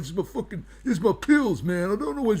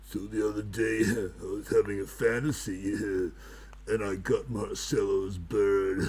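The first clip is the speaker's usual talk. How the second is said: low creepy wizard voice; 9 semitones lower, 9 dB quieter than usual. Acted. Wizard character's low creepy fantasy.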